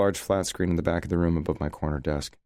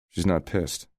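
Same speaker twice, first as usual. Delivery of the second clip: plain reading voice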